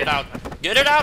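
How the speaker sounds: loud, high frantic shout; panicked yell. Real, not acted. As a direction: shriek it, rising on the repeat. Alarm at devil thing in game.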